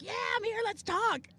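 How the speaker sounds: high, perky imitation; eager chatty voice. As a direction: play the suddenly chatty navigator. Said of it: "you're like".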